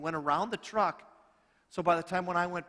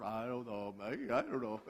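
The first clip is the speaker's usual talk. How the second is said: a lightly voiced, softer watchman mumbling unsure; shrugging watchman; 6 semitones lower, 7 dB quieter than usual. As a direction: shrug it off like a guard who doesn't care.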